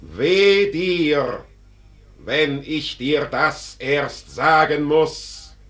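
laut und höher, streng drohend; drohende Strenge. Acted. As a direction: den Toren scharf zurechtweisen. Tod droht dem Tor. (Der Tod droht dem Toren, laut und streng)

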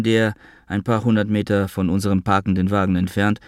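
ordinary delivery, nothing marked

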